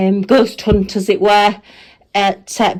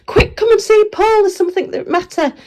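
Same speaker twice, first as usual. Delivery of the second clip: urgent nurse call; 9 semitones higher than usual. Retold or imitated. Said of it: "got one of the other nurses to call somebody and say".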